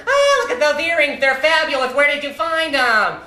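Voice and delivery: retelling his father's mocking act at the table; mock camp waiter. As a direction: ham up the flattery, slowing at the end. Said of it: "And then he turns to my mother".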